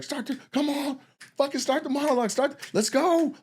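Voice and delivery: high, pushy plea to get going; impatient urging. Retold or imitated. Writer urges Leslie from backstage in a high, impatient call. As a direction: hurry her along from the wings.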